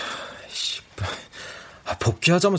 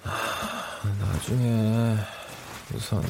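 trailing off into sleep; words fading, dozing off; sleep deprived; 4 semitones lower, 7 dB quieter, slower than usual. Acted. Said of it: "말끝도 맺지 못하고".

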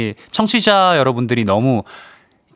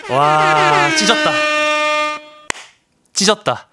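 excited self-praise; 2 semitones higher, slower than usual. Real, not acted. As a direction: pitch jumps up in delight. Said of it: "오늘 한 번의 실수도 없었습니다".

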